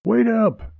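a contained, high-pitched holler; calling out. A narrator's reading. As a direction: call after someone, pitched high. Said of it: "he called".

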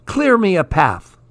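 mock yell clearing the way; a bratty kid hollering from his skis; storybook yell. A narrator's reading.

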